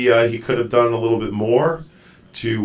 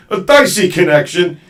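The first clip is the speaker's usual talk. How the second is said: DICEY connection, pitched up and loud; emphatic repeat; 6 semitones higher, 7 dB louder than usual. Real, not acted. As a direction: raise the voice to get DICEY across. Repeats it for guest to hear.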